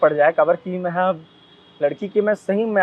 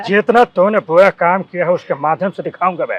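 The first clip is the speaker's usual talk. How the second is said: loud, menacing threat to an enemy; angry threat; 7 dB louder than usual. Retold or imitated. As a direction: bark the threat like a villain. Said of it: "ऐसे करके ना।".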